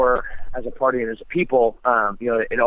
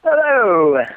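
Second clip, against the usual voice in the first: big, high, loud hello; signature loud greeting; 9 semitones higher, 7 dB louder than usual. Acted. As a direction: burst in with a booming high hello. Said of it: "That's your signature entrance".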